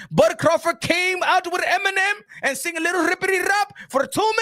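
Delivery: mock Turki rant. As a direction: complain like a spurned big spender. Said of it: "He's like".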